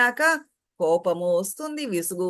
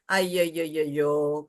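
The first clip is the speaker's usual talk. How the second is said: put-on rueful wail, kept low; mock lament; 6 semitones lower, 3 dB quieter than usual. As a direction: groan it out in mock regret, pitched low. Acting regret after an outburst.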